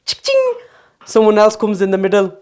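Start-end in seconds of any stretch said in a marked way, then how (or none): 0.0-0.5 mock guests toasting. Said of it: "sitting down in a chair and going".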